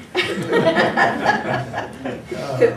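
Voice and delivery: high airy laugh; breathy laugh. Real, not acted. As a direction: laugh along. Laughing at the hotel joke.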